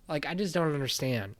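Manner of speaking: ordinary delivery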